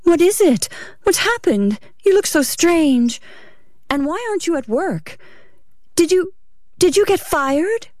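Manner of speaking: high-pitched, anxious wife's questions; Ruth, breathless. A narrator's reading. Wife hurrying beside her strange-looking husband, breathless questioning. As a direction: voice a worried wife, pitched well up. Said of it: "Ruth hurried along breathlessly".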